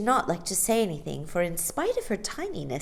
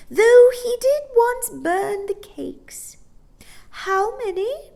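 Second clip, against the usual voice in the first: put-on high sweet old woman; 6 dB louder than usual. A narrator's reading. Old shopkeeper offering gingerbread to children. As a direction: tempt the children kindly.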